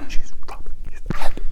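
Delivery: voiceless whisper; mouthed words. Retold or imitated. Daughter silently mouthing to her mom across the table. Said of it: "mouthing at Mom".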